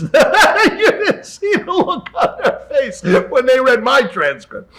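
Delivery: loud, high laughing shout, slow; bursting out laughing while telling it. Real, not acted. Telling the host about the academy, laughing loudly. Laughing through the school story.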